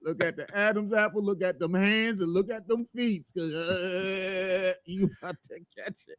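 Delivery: high, loud playful ribbing; teasing taunt. Pretend. Ribbing his co-host about trouble at home, high and playful. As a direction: needle him about what's waiting at home.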